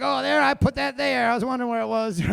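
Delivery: loud, high imitation of old ladies; hammed-up cry of finding something; mock old-lady delight. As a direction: play it loud and innocently surprised. Voicing shoplifting old ladies, loudly.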